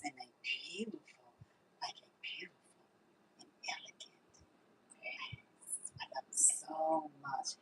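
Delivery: soft hushed praise; whispered adoration. Real, not acted. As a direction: whisper it like a secret love. Whispering over her water.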